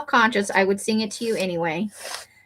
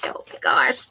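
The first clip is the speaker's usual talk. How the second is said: whispered aside; 9 semitones lower, 3 dB quieter than usual. Real, not acted. Aside after finding third page.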